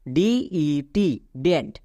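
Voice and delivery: DET spelled out very slowly; slow spelling. Shown as a demonstration. Teacher spelling debt slowly for students. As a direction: draw out each letter. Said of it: "বি এর উচ্চারণ হবে না".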